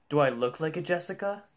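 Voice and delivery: loud, high, incredulous question; indignant disbelief. Acted. Indignant at being called Jessica.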